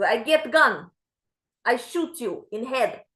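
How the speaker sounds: put-on flat, deadpan Ukrainian-accented threat. Therapist threatens her patient in a Ukrainian accent. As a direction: calmly offer to shoot her, Ukrainian accent. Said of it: "she has an accent like this".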